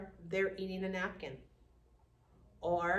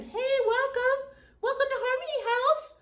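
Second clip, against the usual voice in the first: very high, loud, slow sing-song welcome; cheerful greeter; 8 dB louder than usual. Retold or imitated. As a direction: greet everyone at the door like a happy hostess. Greeter resident voiced.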